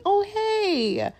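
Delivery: put-on cheery, high-pitched hello; smiley, happy greeting. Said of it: "being smiley and happy and saying".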